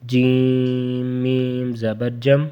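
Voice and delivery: slow, with the م in جم unnasalized; slow drill; no ghunna. Shown as a demonstration. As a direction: keep جم clean without nasal hum. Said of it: "یہاں غنہ نہیں ہوگا".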